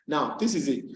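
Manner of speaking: usual speaking voice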